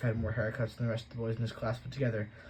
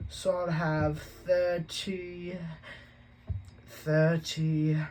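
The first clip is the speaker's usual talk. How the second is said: very slow, higher, labored counting; Dudley, slowly; 7 semitones higher, 3 dB louder, much slower than usual. A narrator's reading. Spoiled boy slowly doing sums, drawn out and high. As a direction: drag out every number like hard work. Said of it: "he said slowly".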